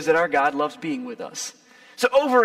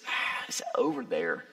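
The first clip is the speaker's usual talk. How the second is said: awed whisper; 12 semitones lower, 6 dB quieter than usual. Acted. God's nature set far apart.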